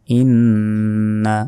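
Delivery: "ইন্না" with the double n held long through the nose; গুন্না. Shown as a demonstration. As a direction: hum the n of "ইন্না" slowly. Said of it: "গুন্না". ("ইন্না" ন-তে লম্বা গুন্না করে)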